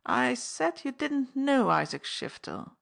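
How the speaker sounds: high, slow, smooth wheedling. Acted. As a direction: slide into an oily charm. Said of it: "he said smoothly".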